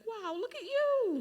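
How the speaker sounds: high, slow, loud wow of amazement; delighted surprise at a grown-up former student; amazed. Retold or imitated. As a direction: marvel at how they've grown. Teacher greeting returning student.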